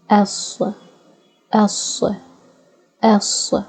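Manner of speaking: "Sa" and "S" with a heavy, gruff s; gruffy S. Shown as a demonstration. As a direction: push air through closed teeth for a thick "S". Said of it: "it's a gruffy S".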